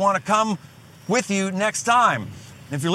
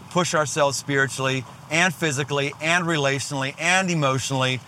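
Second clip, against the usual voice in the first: normal talking voice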